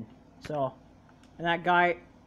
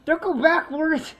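high, slow, loud cry of protest; real gamer yell; exasperated shout; 9 semitones higher, 6 dB louder, slower than usual. Real, not acted. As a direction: yell it at a clueless teammate. Shouting at Melon's play in game.

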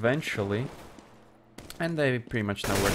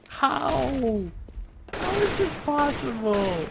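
high, wailing cries of disbelief; stunned disbelief; 7 semitones higher, much slower than usual. Real, not acted. Caster stunned by what Nisco suffers, wailing disbelief.

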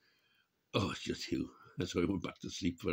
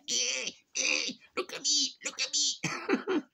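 much higher squeaky lamb begging to be filmed; cheeky lamb voice; slower than usual. Pretend. Lambs clamouring at the vlogger's camera, high and eager. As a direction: play a lamb showing off for the phone.

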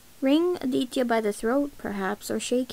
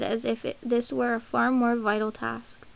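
ordinary delivery

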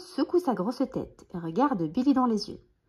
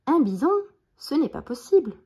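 louder, higher incredulous question; stunned disbelief; 6 semitones higher, 5 dB louder than usual. A narrator's reading. Billy's father hears of the bison, stunned disbelief. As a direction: react as if dreaming. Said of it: "encore tout stupéfait".

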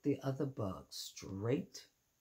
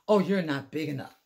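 loud brush-off; 3 semitones higher, 9 dB louder than usual. Acted. Grouchy ladybug dismisses rival.

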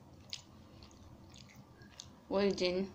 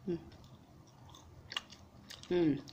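talking while chewing; muffled by food; mouth full of fries; 3 semitones lower, 9 dB quieter than usual. Real, not acted. Seen 4: Fry goes into mouth at 0.1; closed-mouth chewing follows.

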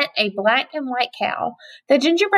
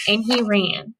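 ordinary delivery, nothing marked

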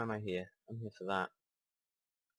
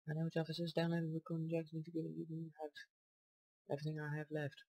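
higher, quick character reading; 7 semitones higher, faster than usual. Acted. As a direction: rattle off the character's line up high. Voicing game character dialogue.

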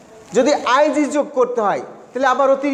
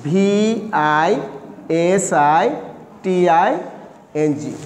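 slow, lower letter-by-letter spelling; spelling aloud slowly; 6 semitones lower, much slower than usual. Shown as a demonstration. Teacher spelling listening slowly for students. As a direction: spell it out for the class.

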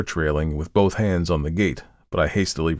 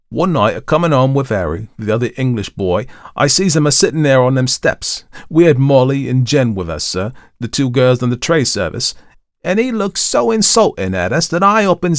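louder, higher Cockney storyteller; Thomas; 7 semitones higher, 5 dB louder than usual. A narrator's reading. Thomas recounts meeting the watchman, in lively Cockney. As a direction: tell the tale in animated Cockney. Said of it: "had not only modified Thomas's Cockney dialect".

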